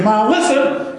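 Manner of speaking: lightly imitating a TV preacher's call to attention; a hint of a pulpit drawl on now listen; mild preacher impression, calling the flock to attention; Charles Stanley. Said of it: "He'll say".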